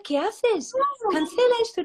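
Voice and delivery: high-pitched alarmed telling-off; mock alarm at a friend's phrase; startled scolding. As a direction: jump in to stop her saying it.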